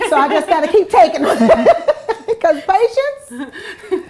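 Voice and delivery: laughing through words. Real, not acted. Mom admitting to the host she's failing, laughing. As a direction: giggle the words out, stretching patience.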